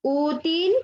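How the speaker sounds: "Útil" with a long stressed "Ú"; stress on penultimate syllable. Shown as a demonstration. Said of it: "En la penúltima sílaba".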